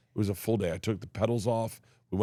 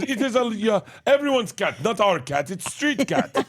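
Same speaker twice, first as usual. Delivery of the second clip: high, loud broken-English neighbor voice; hammed-up foreign neighbor insisting, high and loud; mock foreign accent; 11 semitones higher, 4 dB louder than usual. Neighbor insists it's everyone's cat, high mock accent. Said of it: "She goes".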